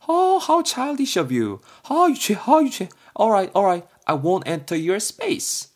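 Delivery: shrill-ish mock scoff; high mocking childish voice. B mocks childishness.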